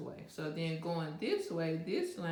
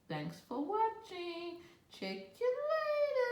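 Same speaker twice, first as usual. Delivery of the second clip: chirpy sign-off. Real, not acted. Sign-off to viewers.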